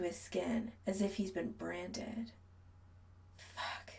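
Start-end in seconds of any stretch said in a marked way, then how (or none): 3.4-3.9 breathless whisper, barely voiced. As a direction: whisper it under your breath. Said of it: "the masseur whispers".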